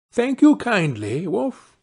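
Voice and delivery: put-on high, sweet storybook girl; high-voiced Little Red; polite little girl. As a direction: answer politely in a high child's voice. Polite girl greets the wolf.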